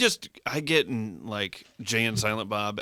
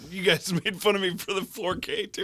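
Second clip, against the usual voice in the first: laughing; 8 semitones higher than usual. Real, not acted. Laughing accusation.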